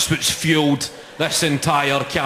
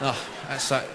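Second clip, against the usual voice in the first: ordinary talk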